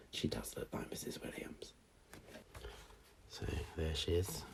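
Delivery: hushed teasing aside; whisper. Real, not acted. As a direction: breathe it as a secret joke. Whispered joke to children.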